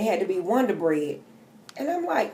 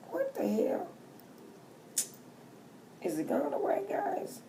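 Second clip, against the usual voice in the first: much higher, soft and baffled; baffled self-talk, pitched up and soft; mock bewildered; 13 semitones higher, 10 dB quieter than usual. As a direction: ask it up high, helplessly. Quoting her bewildered self.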